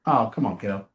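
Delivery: low, softened, unbothered; easygoing brush-off. Acted. Coach models a confident reply to a kid, relaxed. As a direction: gently wave the kid off.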